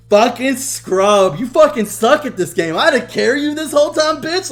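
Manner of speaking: loud, high, rising taunts; cocky trash talk. Retold or imitated. Cousin gloating atop the scoreboard, cocky trash talk. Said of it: "he will run his mouth".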